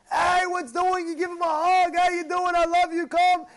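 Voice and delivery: high, loud, effusive greeting; exuberant welcome. Acted. Congregant welcomes a newcomer to shul, exuberantly. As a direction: greet him like a long-missed friend.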